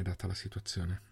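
trailing off softly; resigned concession. A narrator's reading. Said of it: "Melvin annui sospirando".

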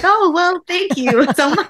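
high and loud, laughing through it; flustered giggly gratitude; laughing, caught off guard by a cheeky compliment; laughing embarrassed thanks. Retold or imitated. Quoting her reply to a joke.

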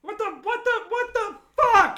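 high shocked shout; shocked yell. Real, not acted. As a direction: yelp in disbelief. Reacting to clip, shocked.

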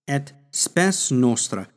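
each Latin syllable of "et spes nostra" spelled out slowly and clearly; teacher models "et spes nostra" slowly for learners to copy; slow Latin demo. Shown as a demonstration. Teacher sounding out the Latin for students, slow and clear. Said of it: "Spes is a derivative".

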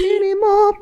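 high, slow mimicking of the question; playful high sing-song echo; mock echo. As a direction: echo it back high and drawn out. Echoing the teasing question.

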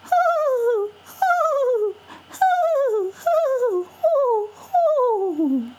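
high, airy mournful dove song; dove coo. Acted. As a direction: coo the dove's sad song. Dove sings her sad song.

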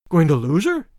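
mildly startled question, rising at the end; startled exclamation. A narrator's reading. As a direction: echo it back in disbelief. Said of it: "exclaimed Tarzan".

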